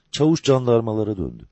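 plain reading voice

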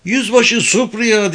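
high, slow, drawn-out call; excited shout. A narrator's reading. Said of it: "diye bağırdı bir itfaiyeci heyecanla".

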